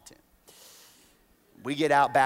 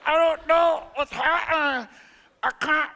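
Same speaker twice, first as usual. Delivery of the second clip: mock panic; 12 semitones higher, 5 dB louder than usual. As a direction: whimper that you can't move. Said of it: "I'm like".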